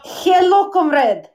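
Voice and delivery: put-on light Russian accent; a hint of a Russian woman. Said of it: "you sound a little Russian to me".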